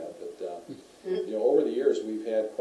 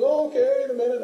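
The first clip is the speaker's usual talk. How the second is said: high, loud put-off from afar; oblivious call back; 11 dB louder than usual. Retold or imitated. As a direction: imitate a friend hollering back, unconcerned. Retold oblivious friend calling back.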